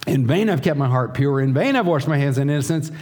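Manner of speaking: the psalmist's bitter outcry, pitched high; voicing the despairing psalmist. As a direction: rant like a man fed up with God. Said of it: "Just up the page, he's saying".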